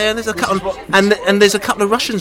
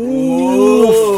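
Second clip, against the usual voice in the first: mock ooh; 9 semitones higher, 5 dB louder than usual. Mock reaction to fighting talk.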